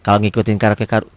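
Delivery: ordinary talk, nothing marked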